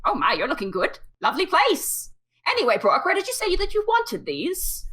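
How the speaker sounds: high, fluttery professor voice; a chirpy, high, bustling old scholar; high and chirpy, fussing over a delivery; Professor Rumblefungus. Acted.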